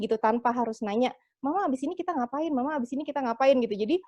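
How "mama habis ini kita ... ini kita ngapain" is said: quick, high-pitched imitated child; mock child's repeated asking; children pestering mama. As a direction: pester mom like a kid, fast and high. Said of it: "semuanya nanya kayak gitu".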